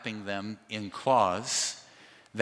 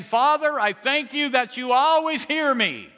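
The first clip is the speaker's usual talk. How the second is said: much higher and louder, proclaimed to the crowd; loud prayer; 13 semitones higher, 7 dB louder than usual. Shown as a demonstration. Jesus praying before mourners, loud proclamation. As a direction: pray so the whole crowd hears. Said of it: "he said it loud enough".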